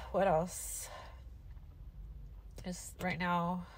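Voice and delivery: ordinary delivery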